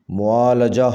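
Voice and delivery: معالجہ with a short a after l, "mu'aalaja"; correct pronunciation. Shown as a demonstration. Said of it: "صحیح تلفظ ہے".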